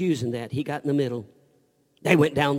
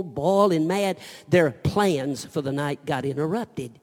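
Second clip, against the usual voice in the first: normal talking voice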